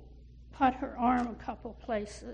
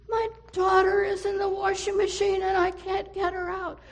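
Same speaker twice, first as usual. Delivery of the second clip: desperate; 6 semitones higher, 4 dB louder than usual. Acted. Mother tells the man.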